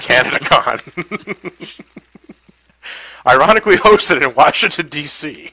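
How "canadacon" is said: drawn out. Pretend. Echoing friend's word slowly.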